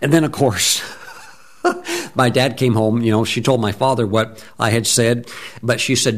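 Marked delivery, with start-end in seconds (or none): 1.6-2.1 quick high chuckle; a brief amused giggle; short high laugh. Real, not acted. Preacher chuckling at the memory, short high laugh.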